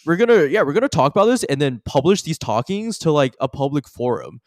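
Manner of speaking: loud, high, fast bro hype. Acted. Bro hyping up his buddy, loud and high. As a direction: pump it up like a gym bro. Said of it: "his homie comes up to him and he goes".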